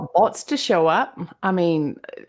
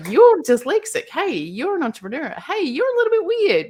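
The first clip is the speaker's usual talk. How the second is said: high, swooping mock announcement; imagined friendly call-out; 8 semitones higher, 4 dB louder than usual. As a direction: call out as if pointing it out, pitched well up. Imagined adviser hailing her.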